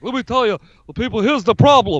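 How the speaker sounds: hurried cranky candidate impression; Bernie Sanders. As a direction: play an exasperated politician making his case. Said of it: "Bernie shoulda went out there and be like".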